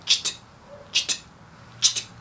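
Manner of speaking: "Tcht" as a soft, voiceless burst; a voiceless "Tcht" drill; practice tcht. Shown as a demonstration. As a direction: model "Tcht" for students to copy. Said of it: "e produza esse som".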